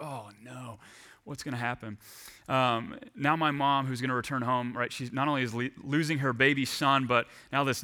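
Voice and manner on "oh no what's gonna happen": worried hush. Retold or imitated. Dreading mom's reaction.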